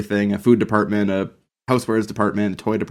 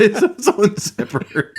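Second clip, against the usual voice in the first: high and slow, giggling through it; laughing; 10 semitones higher, slower than usual. Real, not acted. Friend adds a zipper joke, giggling high and slow. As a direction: dissolve into giggles on the punchline.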